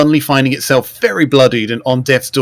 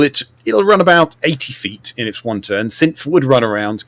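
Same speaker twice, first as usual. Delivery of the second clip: ordinary talk, nothing marked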